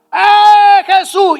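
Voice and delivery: high loud call. Acted. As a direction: shout to get Jesus's attention. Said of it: "revoleaba la capa". (llamada a gritos)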